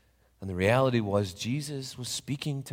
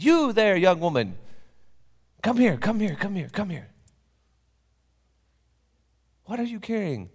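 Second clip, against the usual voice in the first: high, loud, eager calls; calling out; 10 semitones higher, 5 dB louder than usual. Acted. Papa calling the young woman over, high and loud. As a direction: beckon her urgently.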